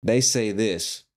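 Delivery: "They say this" said slowly, stress on "They"; slow model statement; slow flat statement. Shown as a demonstration. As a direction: spell out "They say this" with a falling end. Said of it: "Instead of saying that".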